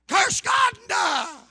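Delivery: loud, high mock shout; angry shout. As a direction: hurl the curse at him, slowly. Wife's bitter curse retold.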